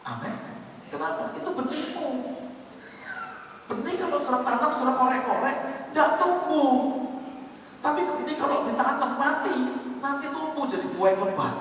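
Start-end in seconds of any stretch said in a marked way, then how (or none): 1.4-11.5 high, raised pulpit voice; preaching with force. Acted. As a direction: preach it out emphatically, pitched up. Preacher raises voice to drive point. (berkhotbah dengan suara tinggi dan tegas)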